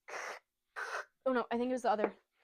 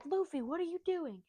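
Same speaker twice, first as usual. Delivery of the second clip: higher-pitched, acted exasperated question; mock alarmed scolding; 7 semitones higher than usual. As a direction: call the child out in put-on alarm. Voicing crew scolding a child.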